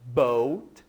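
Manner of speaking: boat said the English way, for students to repeat. Shown as a demonstration. Teacher models boat for students to repeat, in English pronunciation. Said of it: "Repita comigo mais uma vez".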